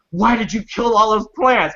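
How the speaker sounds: high-pitched retold scolding; angry grandma. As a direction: imitate grandma demanding an answer, heated. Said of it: "my grandma was hot".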